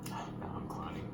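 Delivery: muttered deadpan aside; deadpan mumble. Real, not acted. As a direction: drop it under your breath. Deadpan after joke.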